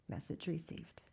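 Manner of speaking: a soft aside to land the joke; deadpan whispered punchline. Acted. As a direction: whisper it, dry and knowing. Dry punchline after the doorbell story.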